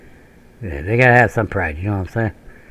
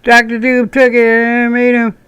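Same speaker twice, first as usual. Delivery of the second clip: high, louder nerdy fan voice; mocking fan; 13 semitones higher, 5 dB louder than usual. Mocking a comic fan arguing plot, high voice. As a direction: squeak it like a know-it-all fan. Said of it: "you're gonna".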